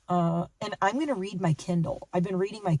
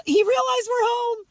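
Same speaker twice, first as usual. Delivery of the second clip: much higher, gushing over the dog; excited pet talk; 4 dB louder than usual. Real, not acted. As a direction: squeal with delight at him. Dog excited she's home.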